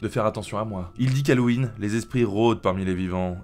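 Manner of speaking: normal talking voice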